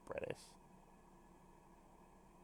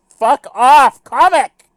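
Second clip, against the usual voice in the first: very loud, high burst of frustration; exasperated shout; 8 semitones higher, 18 dB louder, much slower than usual. Real, not acted. Reader curses the comic after tripping on a word, loud exasperated yell.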